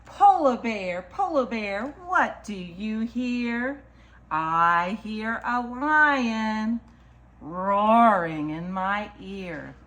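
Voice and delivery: slow, lilting picture-book read; reading to children. Acted. Storyteller reads a picture book to children, lilting. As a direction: read it rhythmically, stretching the key animal words.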